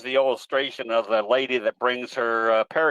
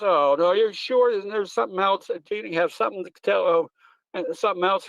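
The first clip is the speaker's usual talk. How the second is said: put-on higher, pleading old lady voice; lightly voiced distraught lady begging; pleading pet owner; 6 semitones higher than usual. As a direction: beg the vet for hope, voice up high. Pet owner pleading in told joke.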